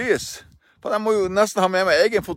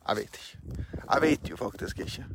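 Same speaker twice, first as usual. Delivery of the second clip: whispered doubt; 7 semitones lower, 10 dB quieter than usual. Real, not acted. Host admitting to listeners he doesn't know, in a whisper. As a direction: whisper it, barely there. Trailing off, unsure of himself.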